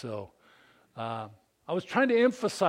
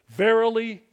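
King James proclamation; 7 dB louder than usual. Acted. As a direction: declaim it like old scripture. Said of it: "some of you from the King James".

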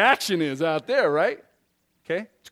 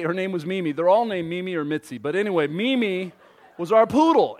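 usual speaking voice

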